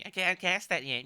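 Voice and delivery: high mocking whine; whiny voice. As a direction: parody the turret's whine. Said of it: "Stop whining at me".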